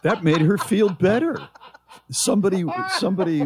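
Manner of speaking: high indignant voice. Real, not acted. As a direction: let indignation push the voice high. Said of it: "my voice goes high".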